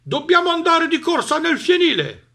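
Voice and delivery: high, loud urgent dog. A narrator's reading. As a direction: hurry them along like a dog. Dog urges animals.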